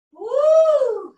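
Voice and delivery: high, loud cheer; excited whoop. Real, not acted. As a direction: whoop to pump them up. Cheering during ab workout.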